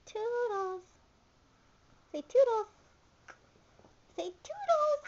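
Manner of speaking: very high, bright coaxing voice; high sing-song coaxing. Real, not acted. Coaxing toddler to say word.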